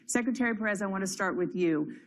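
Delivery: normal talking voice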